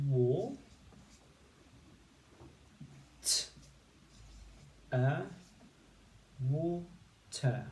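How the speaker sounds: war, t, er said as separate sounds; sounding out water. Shown as a demonstration. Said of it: "the sounds to build the word water".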